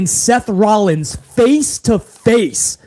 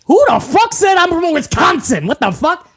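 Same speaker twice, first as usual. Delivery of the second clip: higher, mock-outraged shout; half-laughing indignant yell; indignant outburst; 8 semitones higher than usual. Real, not acted. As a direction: fire it back at the chat. Indignant at chat comment.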